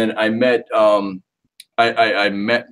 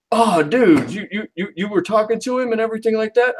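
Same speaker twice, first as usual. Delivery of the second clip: surprised rep; 12 semitones higher than usual. Retold or imitated. Rep's surprised reply, high-pitched.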